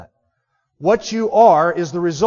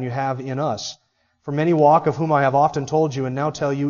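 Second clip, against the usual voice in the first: scripture reading; 4 semitones lower than usual. Acted.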